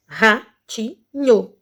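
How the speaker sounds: long gaps between syllables of "Ratinho". Shown as a demonstration. Teacher showing three syllables, "Ratinho" slowly separated. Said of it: "três sílabas".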